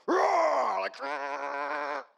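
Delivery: loud, high roar of fury. Retold or imitated. Speaker voicing his rage at seeing Steve, roaring loud and high. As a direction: let the fire in the belly roar out. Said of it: "fire in my belly".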